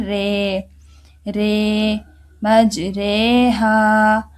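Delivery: रे said between रा and री; मजरे हा with the रे drawn out slowly; रे with imala. Shown as a demonstration. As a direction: lean the vowel of रे toward री. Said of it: "इसको हमने क्या पढ़ना है".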